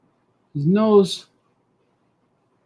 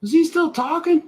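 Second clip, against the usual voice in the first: mock high voice; 12 semitones higher than usual. High mock voice checking viewers.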